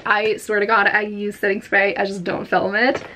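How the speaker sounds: ordinary talk, nothing marked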